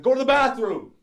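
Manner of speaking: high-pitched mock command; angry mom order. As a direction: order the son off as a fed-up mother. Angry mom ordering her son.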